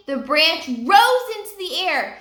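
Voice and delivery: plain reading voice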